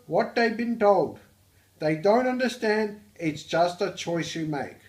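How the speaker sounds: loud, high-pitched Australian; Australian accent. Acted. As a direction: belt it out in a broad, hammed-up Aussie accent. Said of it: "of the Australian accent".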